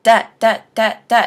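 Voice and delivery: lightly voiced automated phone system; a hint of robot operator; robot phone lady. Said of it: "the automatic system or robot lady would say".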